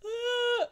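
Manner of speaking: put-on high, loud baby shriek; mock baby shriek. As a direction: let out a startled baby yelp. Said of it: "is like".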